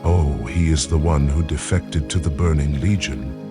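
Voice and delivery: ordinary talk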